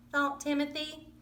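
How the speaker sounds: usual speaking voice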